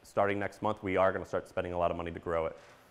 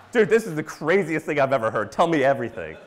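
louder, eager retold rave; amazed and hungry for the whole story; excited disbelief; 5 semitones higher, 5 dB louder than usual. Speaker telling the crowd how he reacted to the Missouri guy, excited.

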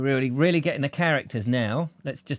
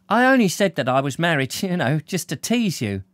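higher woman's voice with a laugh; young woman voice; 6 semitones higher, 3 dB louder than usual. Acted. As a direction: tease with a laugh. Blonde laughing a little.

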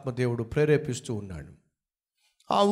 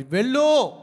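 commanding voice of God; 10 semitones higher, 6 dB louder, slower than usual. Retold or imitated.

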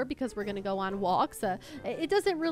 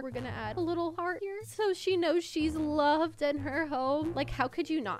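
usual speaking voice

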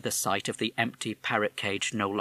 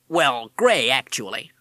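put-on American accent; 3 semitones higher, 8 dB louder, slower than usual. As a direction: talk like a fast-talking American wise guy. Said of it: "You have an American accent".

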